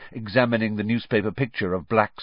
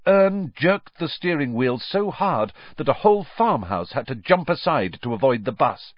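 usual speaking voice

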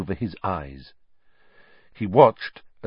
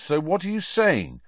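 higher, pressed voice; tense Ron; 11 semitones higher than usual. A narrator's reading. Ron confronting Harry, tense and high. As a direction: push the question tensely. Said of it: "looking very tense".

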